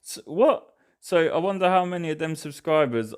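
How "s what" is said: surprised. Real, not acted. Commentator shocked by the mass subscriptions, surprised. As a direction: jolt out a shocked "what".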